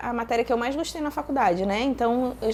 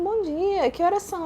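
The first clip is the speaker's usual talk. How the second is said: higher-pitched retold morning reply; imitated partner; 8 semitones higher than usual. Partner answering her wake-up greeting, lightly imitated. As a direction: lightly voice a partner asking the time.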